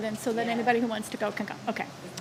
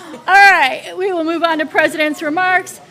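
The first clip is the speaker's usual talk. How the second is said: loud, raised all right; loud call to order; 4 semitones higher, 9 dB louder than usual. Real, not acted. Board chair to squirrely staff, loud call to order. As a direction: grab the room's attention. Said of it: "you guys are squirrely today".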